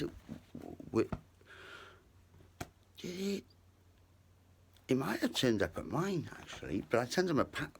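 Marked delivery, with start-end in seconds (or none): none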